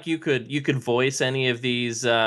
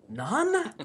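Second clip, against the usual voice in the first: surprised; 14 semitones higher, 3 dB quieter than usual. Real, not acted. Host surprised at no acting work.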